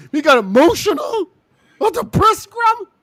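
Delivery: loud, high, worked-up yell; emotional outburst. Real, not acted. Mocking the wrestler's emotion, yelling high and loud. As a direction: let it all out, voice up high. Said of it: "I'm emotional right now".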